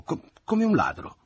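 mother finally spits out her insult; flustered indignation. Acted. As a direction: stumble then land the word in outrage. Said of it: "nel disordine del suo sdegno".

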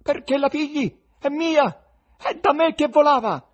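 loud, high shout from the box; shouting spectator. A narrator's reading. Spectator in the box yelling over the crowd, loud. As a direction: yell to claim the money. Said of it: "si udì una voce".

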